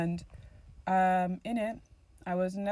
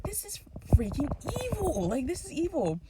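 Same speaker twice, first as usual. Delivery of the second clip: outraged; 9 semitones higher, 4 dB louder than usual. Retold or imitated. Angry response to sister.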